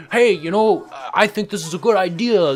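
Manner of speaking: mock politician voice. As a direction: sneer through fake sympathy. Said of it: "House of Representatives was like".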